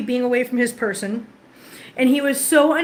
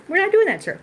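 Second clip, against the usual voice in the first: pitched way up, sing-song telling-off; high mock-indignant scolding; 7 semitones higher, faster than usual. Real, not acted. Scolding the cat in the closet, high mock indignation. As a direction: raise the voice high in mock outrage.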